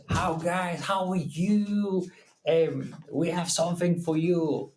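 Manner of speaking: mock American accent. Retelling the Australian merino caller's voice, in a mock American accent. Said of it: "with kind of strange American ac".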